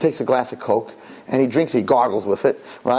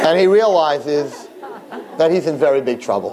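laughing through words. Real, not acted. Preacher finishing the joke, laughing as he speaks. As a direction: say it while still laughing.

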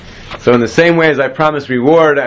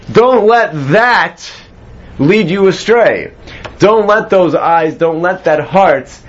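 higher, slow and deliberate, pressing each word; emphatic plea; 5 semitones higher, slower than usual. Real, not acted. Teacher urging his class not to be led astray, slow and emphatic. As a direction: plead with them slowly, leaning on "don't".

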